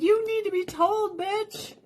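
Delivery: very high acted squeal; emotional squeal. Telling friend she deserves the praise, high emotional squeal. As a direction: squeeze it out way up high. Acts out her emotional reply.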